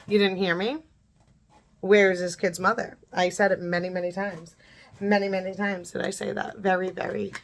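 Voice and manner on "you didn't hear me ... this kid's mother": ordinary talk, nothing marked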